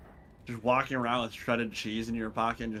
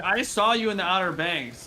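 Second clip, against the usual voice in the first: lightly mocking, higher and louder quote; imitated pushy stranger; 7 semitones higher, 5 dB louder than usual. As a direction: imitate an over-eager guy insisting. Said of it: "Like that guy was like".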